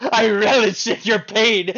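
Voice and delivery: loud, high, hammed-up gloating; mock gloating villain. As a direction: gloat loudly through the laughter. Mock villain gloating amid laughter.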